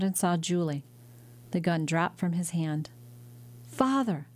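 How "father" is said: high, breathless cry of joy; joyful cry. Acted. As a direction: call out to father in relief. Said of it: "the girl cried".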